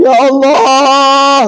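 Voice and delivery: high, loud, very slow mock wail. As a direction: wail it out like a grieving husband faking grief. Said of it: "makin kuat nangis bapak tuh".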